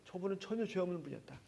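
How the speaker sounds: soft, hushed and awed; imitating a soldier's quiet realization; involuntary confession. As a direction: let it slip out under the breath. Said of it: "자기도 모르게".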